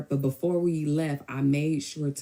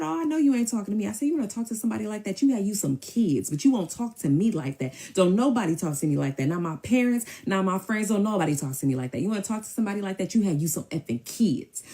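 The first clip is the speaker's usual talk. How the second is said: heated retelling, higher at first; retold scolding, emphatic; indignant telling-off; 6 semitones higher than usual. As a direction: put him in his place. Acted quarrel, telling him off.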